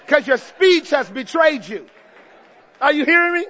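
animated preacher acting out the story; fired-up preaching. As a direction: drive the sermon home at full volume. Preaches at full force.

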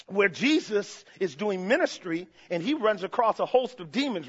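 usual speaking voice

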